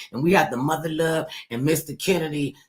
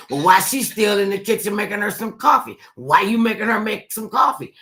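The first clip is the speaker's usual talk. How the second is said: sister accusing; 6 semitones higher, 6 dB louder than usual. Retold or imitated.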